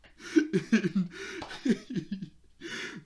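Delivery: long, high, loud laugh; high giggling fit. Real, not acted. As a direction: burst out in a real laugh. Laughing at the clip.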